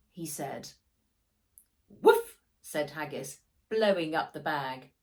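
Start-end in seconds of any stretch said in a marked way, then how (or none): 1.9-2.4 a loud, high barked woof; dog bark. A narrator's reading. The dog Haggis answers Max with a loud high bark.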